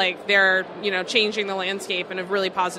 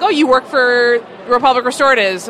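higher, louder imitation of shocked strangers; mock shock; 4 semitones higher, 8 dB louder than usual. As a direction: imitate someone taken aback, voice lifted. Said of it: "People are always shocked".

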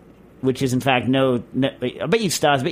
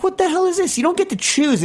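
imitated outrage, pitched way up; high-pitched protest at being corrected; indignant customer. Mike protesting to the counterman over a milkshake, indignant. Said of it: "And Mike was like".

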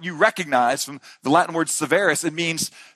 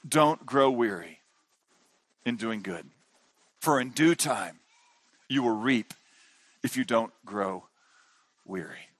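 much softer, slow, lower verse; soft slow recital; 9 semitones lower, 8 dB quieter than usual. Real, not acted. Pastor reciting the key verse to parents, soft and slow. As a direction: let each phrase land softly, reverently.

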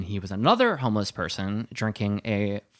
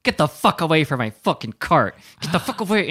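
loud furious mock shout; retold angry yell; 6 semitones higher, 6 dB louder than usual. Homeless woman yelling at the drunk man, loud and furious. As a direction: scream it at the man. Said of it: "she's like".

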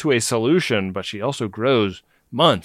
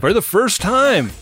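high, loud echo of the words; mock awestruck echo; 10 semitones higher, 5 dB louder than usual. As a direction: repeat it with exaggerated wonder. Echoes partner, mock awe.

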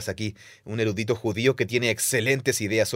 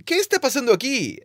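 put-on puzzled child; 13 semitones higher than usual. Kids wondering at the law.

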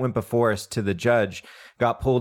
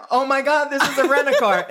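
high, louder put-on shock; mock surprised; 4 dB louder than usual. [name] realizing the rental's lights were off, mock surprised. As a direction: gasp out the realization.

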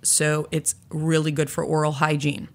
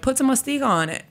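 high comic pitchman voice; spoof voice; 8 semitones higher, faster than usual. Retold or imitated. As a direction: sell it like a goofy ad. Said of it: "this little spoof video where I was like".

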